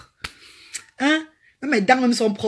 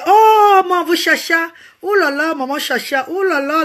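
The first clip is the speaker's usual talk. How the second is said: high, loud outburst of delight; thrilled greeting; 7 semitones higher, 5 dB louder than usual. Real, not acted. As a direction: cry out for joy at the name. Greeting a viewer live.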